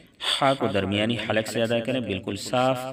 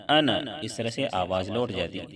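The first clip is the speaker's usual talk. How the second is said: normal talking voice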